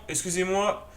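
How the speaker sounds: ordinary talk, nothing marked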